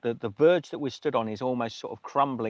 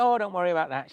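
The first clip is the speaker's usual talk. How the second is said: higher-pitched, breezy imitation; retold reassuring officer; 7 semitones higher, faster than usual. Police officer reassuring a wet passenger, higher-pitched imitation. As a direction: wave the worry away in her lifted voice.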